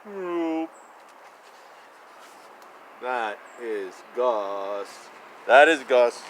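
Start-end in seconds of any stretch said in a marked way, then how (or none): 0.1-5.1 much lower, slow, soft dog voice; grumpy dog voice. Pretend. Reading to child as Gus, low slow dog voice.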